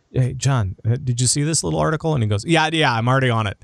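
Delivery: ordinary delivery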